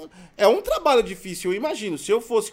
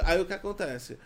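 ordinary talk

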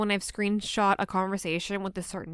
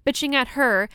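normal talking voice

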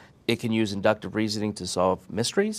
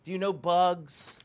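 mock indignant rant; 8 semitones higher than usual. Mock ranting at a British guest.

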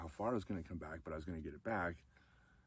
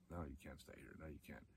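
much softer, steady no; quiet firm refusal; 3 semitones lower, 12 dB quieter than usual. Retold or imitated. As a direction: say it low and gentle but final, tough love. Friend's tough love refusal.

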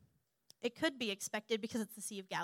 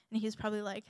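ordinary delivery

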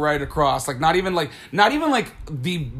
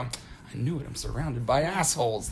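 much softer, lower, dry delivery; deadpan aside; 4 semitones lower, 8 dB quieter than usual. Retold or imitated. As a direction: turn around and drop it quietly. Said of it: "I turn around and I go".

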